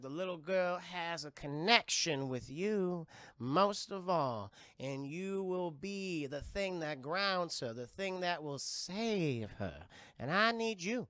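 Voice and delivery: slow, solemn Southern drawl; Zelda's Southern accent. Retold or imitated. Seer telling mother she will save her girl, slow Southern drawl. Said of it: "a very thick Southern accent".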